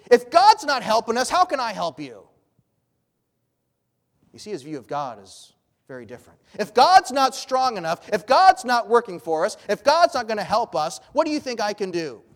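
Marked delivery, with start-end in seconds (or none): 0.1-2.2 higher, louder bitter retort; bitter sarcasm. Acted. King scorns the woman's plea, bitter and sarcastic. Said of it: "a sarcastic but a bitter tone". 6.6-12.2 higher, louder bitter sarcasm; sarcastic, bitter. Acted. King blames God before the woman, bitter sarcasm. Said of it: "a sarcastic but a bitter tone".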